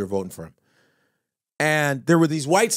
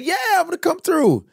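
eager reply. Retold or imitated.

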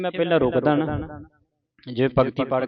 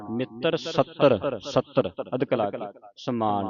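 ordinary talk, nothing marked

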